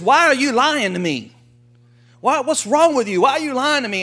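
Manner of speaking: indignant king. Retold or imitated. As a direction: play the outraged king confronting a liar. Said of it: "he goes to Abraham and he says".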